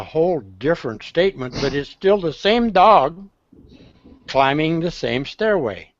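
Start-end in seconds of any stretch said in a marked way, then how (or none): none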